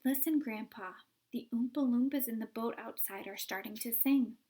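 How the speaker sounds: ordinary delivery, nothing marked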